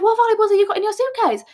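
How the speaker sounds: shrill-free indignant shout of disbelief; incredulous outburst. Retold or imitated. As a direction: fire it back in total disbelief. Incredulous at boyfriend's packing.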